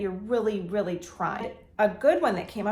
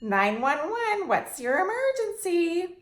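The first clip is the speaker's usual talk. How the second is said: mock dispatcher; 8 semitones higher, 3 dB louder, slower than usual. Mock dispatcher answering phone.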